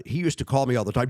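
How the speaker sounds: usual speaking voice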